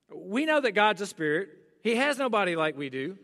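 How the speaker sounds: ordinary talk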